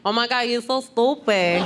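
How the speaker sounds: put-on cutesy Bronx Puerto Rican girl accent; thick Puerto Rican accent. Girl she grew up with teasing, thick Puerto Rican accent. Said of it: "that really thick, like, Rosie Perez, like, Puerto Rican".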